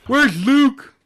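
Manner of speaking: high loud call. Acted. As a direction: call out for someone.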